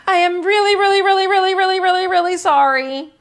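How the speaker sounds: high, loud sing-song apology; pleading little girl. Acted. Lily's drawing begs everyone's forgiveness, high and pleading.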